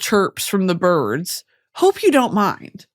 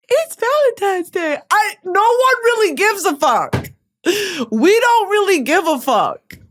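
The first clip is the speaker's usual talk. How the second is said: higher, lightly mocking squeal; mock girly quote; 8 semitones higher than usual. Reworking the viral video quote for Valentine's Day, high. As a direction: parody the video girl about Valentine's.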